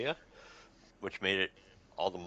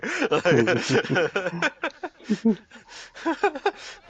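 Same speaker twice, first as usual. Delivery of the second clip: long, high, loud peals of laughter; really cracking up, helpless; laughing; 11 semitones higher, 9 dB louder than usual. Real, not acted. Reviewer losing it over the plot twist, laughing hard.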